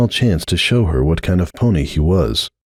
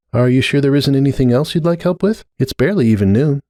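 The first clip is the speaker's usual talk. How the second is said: a little higher, lightly voiced helpful friend; Starlight voice; 6 semitones higher than usual. A narrator's reading. Starlight offering Cupcake more help, a lightly voiced helpful friend. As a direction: lift the voice gently for eager Starlight.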